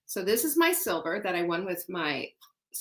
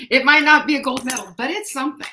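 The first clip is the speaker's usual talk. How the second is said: laughing; 7 semitones higher, 8 dB louder, faster than usual. Real, not acted. Laughing at her own medal.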